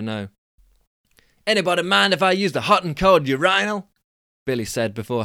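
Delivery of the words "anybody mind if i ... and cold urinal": high, loud Californian dude scoff. Acted. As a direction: squeak up the pitch like a cocky Californian. Billy voiced.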